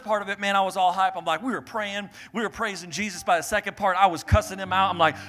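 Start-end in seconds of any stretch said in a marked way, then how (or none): none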